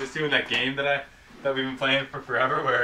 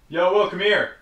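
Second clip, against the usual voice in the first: higher, louder call across the house; 6 semitones higher, 3 dB louder than usual. Real, not acted. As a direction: call him from the basement. Calling brother from dark room.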